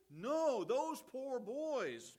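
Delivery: loud, high, drawn-out comic correction; emphatic mock pity. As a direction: overrule it loudly, stretching the words high.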